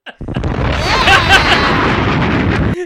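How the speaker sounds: shrieking laughter; loud high laugh. Real, not acted. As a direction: burst out laughing hard. Hysterical laughing at story.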